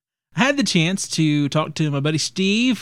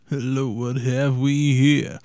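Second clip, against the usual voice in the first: slow character voice; 2 semitones lower, slower than usual. Acted.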